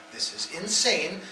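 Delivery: normal talking voice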